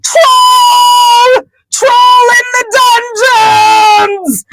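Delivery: very high, wailing mock shout, stretched out; panicked alarm cry. Professor runs into the feast to warn everyone, high panicked cry. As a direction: wail the warning in a hammed-up falsetto.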